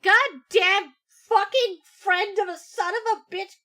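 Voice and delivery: loud slow cursing yell; furious shout. Real, not acted. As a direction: swear at the screen, full force. Cursing at emotional clip.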